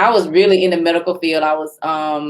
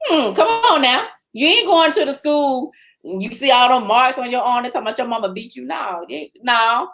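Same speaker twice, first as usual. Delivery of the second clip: scolding retort; 6 semitones higher than usual. Retold or imitated. Acting out her side, scolding.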